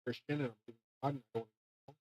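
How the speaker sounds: quiet, low retold plea, hurrying at the end; retold begging. Telling how he begged them to stop, subdued pleading. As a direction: recall the pleading softly, in an undertone. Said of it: "I was just begging them".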